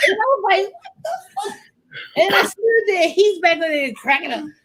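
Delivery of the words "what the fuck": very high, softer squeal; reenacted high-pitched bewilderment; shocked disbelief. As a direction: squeak it out, acting stunned.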